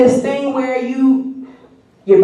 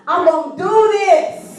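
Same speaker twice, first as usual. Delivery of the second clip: higher, slow, determined declaration; bold resolve; 8 semitones higher, slower than usual. Retold or imitated. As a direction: announce it proudly, full of confidence. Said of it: "I got on the boat".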